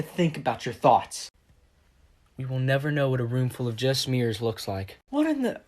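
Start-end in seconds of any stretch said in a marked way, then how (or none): 5.1-5.6 startled. Acted. Marvin appears again.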